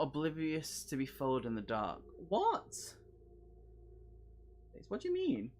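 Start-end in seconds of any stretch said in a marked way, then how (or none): 2.3-5.5 high, incredulous What; mock disbelief. Player reacting to the book's jab, incredulous. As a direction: squeak out the disbelief. Reacting to being called oblivious.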